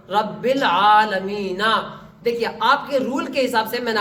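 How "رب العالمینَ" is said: slow, syllable by syllable; measured, drawn-out teaching recitation; spelling-out recitation. Shown as a demonstration. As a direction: draw out each syllable for learners. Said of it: "آج میں ہجا کر رہا ہوں".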